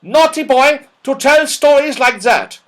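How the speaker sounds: put-on high, loud scolding old dame; loud frightening voice. Dame Snap scolding Joe for lying, loud and frightening. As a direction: scold the boy like a fierce schoolmistress. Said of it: "in such a loud and frightening voice".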